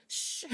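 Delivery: quiet shushing; soft shush. Retold or imitated. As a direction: hush her so he can listen. Friend hushing her in the conference.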